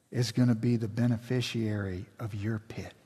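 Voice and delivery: whispered, almost no voice; a breathy pulpit whisper; hushed whisper. Real, not acted. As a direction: drop to a whisper for weight. Pastor drops to a hush.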